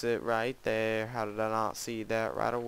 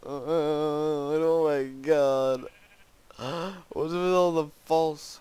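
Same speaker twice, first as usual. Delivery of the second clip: exasperated; 5 semitones higher, 5 dB louder, much slower than usual. Real, not acted. Despair at map.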